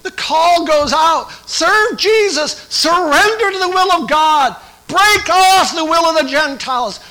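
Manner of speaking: a revival preacher calling for surrender; full-force preaching. Acted. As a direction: preach it out to the whole room.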